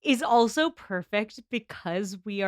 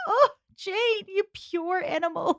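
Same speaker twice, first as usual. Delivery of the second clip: fond high exclamation; 11 semitones higher, slower than usual. Real, not acted.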